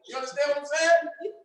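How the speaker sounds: normal talking voice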